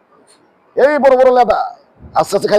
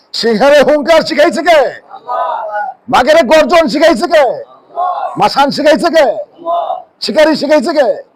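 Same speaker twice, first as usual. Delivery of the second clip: slow, raised, shouted sermon questions; fiery preaching; 4 semitones higher, slower than usual. Acted. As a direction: thunder each question at the crowd. Preaching at full force. (ধীরে, জোর গলায় ওয়াজের হাঁক)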